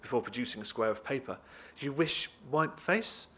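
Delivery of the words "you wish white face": slow, higher halting questioning; careful, drawn-out words in broken English; foreign woman's broken English. Acted. Visitor offers Jacob a cloth, halting broken English.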